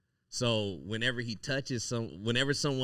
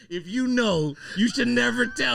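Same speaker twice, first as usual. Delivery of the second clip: much louder and higher, laughing through it; excited; 12 semitones higher, 9 dB louder than usual. Retold or imitated. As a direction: blurt it out, thrilled. Recounting meeting the actor, laughing.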